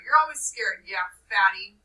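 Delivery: loud mocking taunt; jeering Jack. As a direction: sneer at Piggy. Jack mocks Piggy.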